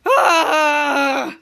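loud, drawn-out "ahh"; loud wordless holler; mock scare cry. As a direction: imitate a creature's scare noise. One of the mole people scaring him.

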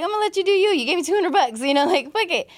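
breezy, half-laughing go-along; high giggly whatever-goes voice; carefree shrug. Retold or imitated. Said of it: "but I was like".

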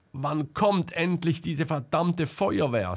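high, pleading complaint; desperate outburst at the delay. A narrator's reading. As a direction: speak in rising despair. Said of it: "sagte Selma verzweifelt".

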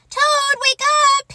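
very high, loud waking call; crying out. Acted. Frog at Toad's door waking him, high cry. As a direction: holler to rouse someone indoors. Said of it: "he cried".

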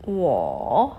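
"wo" dipping low, then rising; third tone. Shown as a demonstration. Teacher shows students the dipping tone, stretched "wo". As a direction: press down mid-"wo", then swing up. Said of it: "ada penekanan di tengah-tengah".

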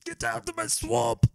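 very high, loud, slow ogre bellow; ogre roar. Pretend. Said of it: "is it that good?".

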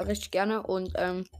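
usual speaking voice

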